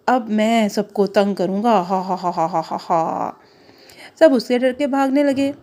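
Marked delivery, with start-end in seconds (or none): 0.1-3.4 lower, slowing evil laugh; villain laugh. Acted. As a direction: gloat like a little villain. Caterpillar plans mischief, laughs.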